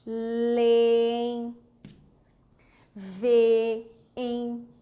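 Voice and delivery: "Leem, veem" stretched with pauses; drawn-out vowels. Shown as a demonstration. Teacher lists identical-vowel hiatuses, "Leem, veem" drawn out. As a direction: hold each double vowel in "Leem, veem". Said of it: "formado por vogais idênticas?".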